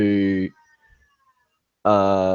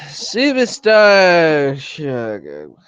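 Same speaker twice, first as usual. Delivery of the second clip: slow, high, stretched ring announcer call; 6 semitones higher, 5 dB louder, much slower than usual. Acted. Introducing a wrestler to the crowd with a slow, drawn-out ring call. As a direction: drag the name out like a ring announcer.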